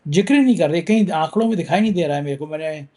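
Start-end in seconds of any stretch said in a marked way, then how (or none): none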